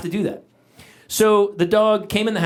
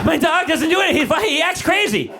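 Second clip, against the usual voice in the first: high, fast, bewildered owner; clueless dog owner; 9 semitones higher than usual. Retold or imitated. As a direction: play the frantic owner baffled by the dog. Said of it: "the person's like".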